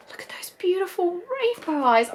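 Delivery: breathless little whisper of wonder; awed whisper. Real, not acted. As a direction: hush it like you can't believe how pretty it is. Awed at the doll's rainbow eyes.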